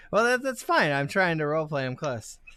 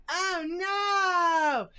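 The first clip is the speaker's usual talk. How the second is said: high, wailing character shout; Taters' panicked cry. Acted. Game master plays Taters running away, hammed-up high wail. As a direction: wail it out high as the fleeing dwarf. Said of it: "and then he's like".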